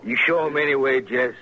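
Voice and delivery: loud taunting command; loud order. Acted. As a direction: bark it like an order across the bar. Gunman orders a saloon shooting.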